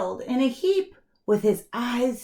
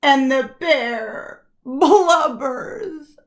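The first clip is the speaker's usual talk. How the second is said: louder wailing, cry in the voice; mock blubbering; 6 semitones higher, 5 dB louder than usual. A narrator's reading. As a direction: narrate it as if the bear is sobbing. Bear wails and cries.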